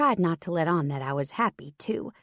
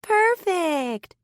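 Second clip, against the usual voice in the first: sarcastic drawl pitched much higher; Turner drawling; 12 semitones higher, 4 dB louder than usual. A narrator's reading. Turner mocking Castile's visit, high sarcastic drawl. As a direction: drag out the word, mocking. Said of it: "Turner drawled".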